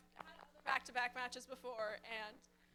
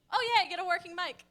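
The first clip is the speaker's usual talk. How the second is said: calling out loud; 5 semitones higher, 14 dB louder than usual. Real, not acted. Calling out for working mic.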